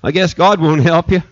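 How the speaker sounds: dry remark breaking into a laugh; sarcastic jab with a chuckle; laughing sarcasm. Real, not acted. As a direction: say it then laugh it off.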